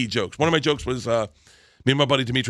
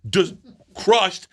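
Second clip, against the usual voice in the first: usual speaking voice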